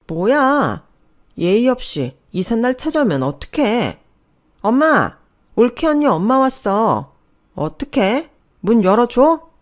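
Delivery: high, loud, indignant fuss; annoyed alarm. Acted. Sister-in-law spots unwelcome visitor on the intercom, indignant. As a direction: complain loudly and call out to Mom.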